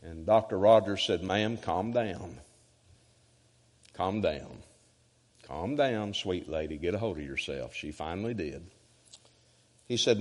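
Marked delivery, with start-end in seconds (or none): 1.2-7.8 calming. Retold or imitated. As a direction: gently settle down a hysterical caller. Pastor soothing a screaming caller.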